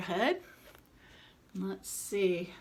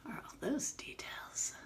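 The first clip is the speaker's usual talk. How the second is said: hushed whisper; 9 dB quieter than usual. Real, not acted. Aside to kids showing picture.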